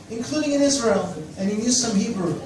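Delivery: usual speaking voice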